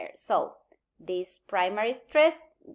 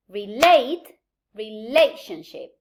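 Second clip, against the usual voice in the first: loud LATE in "Relate", same beat in "relationship"; stress on LATE/LA; 6 dB louder than usual. Shown as a demonstration. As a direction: punch the second syllable of "Relate" and "relationship". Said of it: "The stress pattern of the root is the same".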